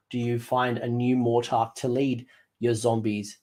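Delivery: usual speaking voice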